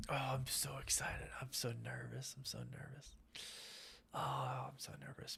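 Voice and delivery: breathless whispered jitters, fading almost to nothing; nervous whisper. Real, not acted. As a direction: whisper it to yourself, buzzing with nerves. Excited jittery whisper to viewers.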